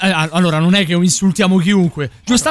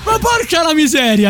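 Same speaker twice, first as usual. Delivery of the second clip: exasperated shout; 7 semitones higher than usual. Real, not acted. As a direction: burst out in exasperation. Exclaiming loudly.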